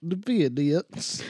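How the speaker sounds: slow The FedEx said as Vee-diks; hammed-up Russian FedEx; mock Russian accent. Shown as a demonstration. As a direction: drag out FedEx like a Russian villain. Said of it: "said it in your accent".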